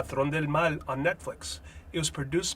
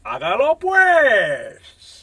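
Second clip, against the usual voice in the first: "hágalo pues" very slow, much higher and louder, rising and falling; drawn-out sign-off; 9 semitones higher, 12 dB louder, much slower than usual. Shown as a demonstration. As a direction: stretch out "hágalo pues" with full swing. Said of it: "slow upward and then downward pitch".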